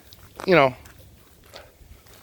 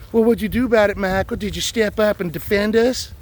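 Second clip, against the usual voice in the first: imagined critic; 8 semitones higher than usual. Retold or imitated. Imagined critic challenging him.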